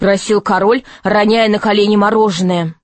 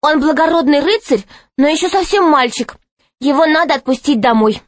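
put-on higher-pitched, pleading girl; girl interjecting; 6 semitones higher than usual. A narrator's reading. Alisa pleading for her friend's release, pitched up. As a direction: plead in a raised, youthful voice. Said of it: "вмешалась в спор Алиса".

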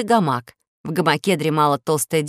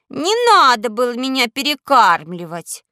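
put-on high, loud, whiny spoiled girl; capricious little princess; 6 semitones higher, 5 dB louder than usual. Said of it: "капризно сказала".

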